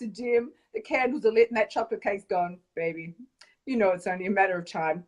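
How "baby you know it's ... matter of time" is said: low, soft, seductive purr; deep sexy voice. Pretend. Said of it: "a really deep, sexy voice".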